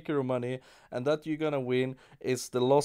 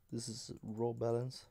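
ordinary talk

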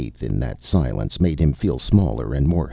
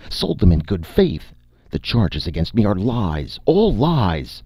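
high, louder frantic protest; Seth shouting; 6 semitones higher, 3 dB louder than usual. A narrator's reading. Accused needlesmith shouting his innocence at the judge, high and loud. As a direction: protest shrilly, pleading innocence. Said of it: "Seth resumed shouting at once".

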